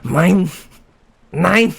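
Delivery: high, punched mock German; German accent. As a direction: bark single words in a put-on German accent. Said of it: "trying to say in this accent".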